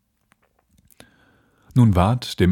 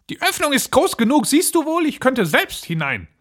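very high-pitched, exasperated witch; impatient old witch. Acted. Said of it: "sagte die Alte". (ungeduldige alte Hexe)

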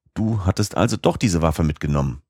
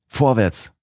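higher, stronger repeat of the call; louder eager cry; 6 semitones higher than usual. A narrator's reading. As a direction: push it up higher, bursting to go. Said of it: "rief ich aus". (höher und drängender wiederholter Ruf)

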